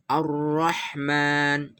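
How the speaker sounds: Ar-Rahman slowly, the lam merged into a doubled rr; slow recitation of Ar-Rahman with doubled r; correct tajwid. Shown as a demonstration. (Ar-Rahman dibaca pelan, lam lebur ke ra)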